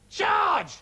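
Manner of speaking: very high, loud shout; grandma screeching for George; shrieking yell. A narrator's reading. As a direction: screech his name. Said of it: "Grandma yelled".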